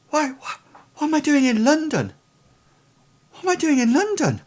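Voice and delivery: put-on very high-pitched, bewildered boy; high voice of a confused lad. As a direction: pitch it right up, lost and nervous. Plays bewildered, nervous boy.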